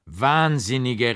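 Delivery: slow, mocking, contemptuous cry; scornful cry. A narrator's reading. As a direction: drag the insult out with scorn. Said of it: "rief ich".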